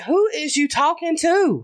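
high, slow, drawn-out challenge; indignant mom. Retold or imitated. Mom to her kids, indignant challenge. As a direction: stretch it out as a warning to a kid.

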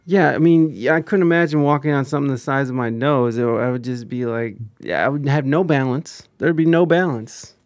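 usual speaking voice

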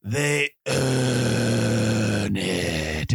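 slow, deliberate commercial spokesman; old man commercial voice. Retold or imitated. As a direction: land hard on "earn it". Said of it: "He's like".

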